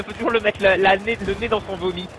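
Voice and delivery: usual speaking voice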